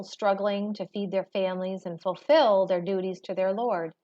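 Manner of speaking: usual speaking voice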